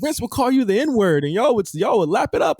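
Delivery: high, mock-outraged reading; mocking quote. Reading out the tweet to his cohosts, high and mocking. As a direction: sneer it out like the tweet.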